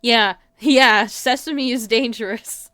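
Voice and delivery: ordinary talk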